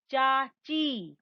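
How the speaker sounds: "चाची" very slow, both vowels held; long vowel demo. Shown as a demonstration. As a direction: draw out the final ई of "चाची". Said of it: "उच्चारण का अंतर दिखाया है".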